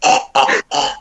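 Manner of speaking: voiceless choking noises; imitated choking. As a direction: mimic gagging for air with no voice. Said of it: "making the choking noises".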